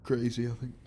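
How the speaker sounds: groggy and tired from a big night; hungover. Real, not acted. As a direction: talk like you barely slept after partying. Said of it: "As I said, I'm hungover".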